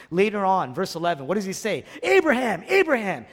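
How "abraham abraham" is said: calling the name out loud and high, a touch of weeping; weeping God calling. Acted. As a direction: cry the name twice, lightly grieving. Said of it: "He's crying, he's weeping".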